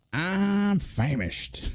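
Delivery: drawn-out, high, hammed-up Southern accent; attempted accent. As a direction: stretch it slowly in a mock Southern American accent. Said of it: "you said with a Yorkshire accent".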